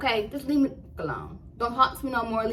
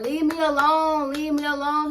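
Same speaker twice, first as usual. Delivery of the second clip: raised, higher voice, pushing him away; yelling; 7 semitones higher, 4 dB louder than usual. Retold or imitated. Yelling at the stalker through her dorm door, loud and high.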